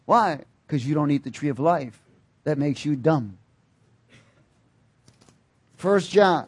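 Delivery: usual speaking voice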